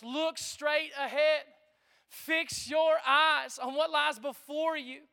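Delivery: slow and deliberate, each word weighted; slow scripture emphasis. Acted. Preacher slowing down to press the verse on the church. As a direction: lean on every word of the verse. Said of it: "preacher stresses scripture".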